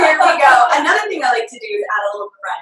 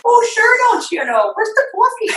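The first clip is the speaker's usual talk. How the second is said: a hint of a Minnesota accent, pitched up in bursts; mother's Minnesota accent; 10 semitones higher, 3 dB louder than usual. Imitating her mother arriving, lightly Minnesota-accented and high. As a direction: Minnesota mom accent, chirpy and high on the first and last lines. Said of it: "New York, Minnesota accent".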